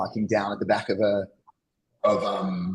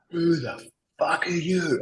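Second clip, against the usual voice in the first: higher, slow, menacing growl of a big tradie; mock angry bloke; 8 semitones higher, much slower than usual. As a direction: play the furious giant blocked in for hours. Huge angry tradie confronts him.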